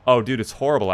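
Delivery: ordinary talk, nothing marked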